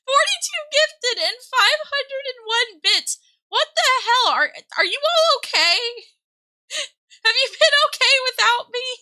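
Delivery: loud, high-pitched astonishment; overwhelmed, pitch and volume way up; incredulous. Real, not acted. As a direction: demand an answer in amazed disbelief. Stunned by the generous gifts.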